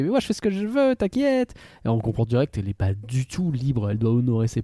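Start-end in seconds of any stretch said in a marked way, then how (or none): 0.0-1.5 put-on high, breezy teenage girl; carefree teen girl voice. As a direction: toss it off like a cocky teen. Voicing Mei Mei's carefree claim.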